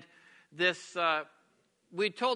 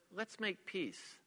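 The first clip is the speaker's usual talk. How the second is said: soft, slow offer of peace; loving, peacemaking; 10 dB quieter, much slower than usual. Acted. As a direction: gently lay down the weapons. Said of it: "Love says".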